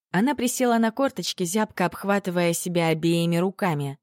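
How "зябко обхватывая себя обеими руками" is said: usual speaking voice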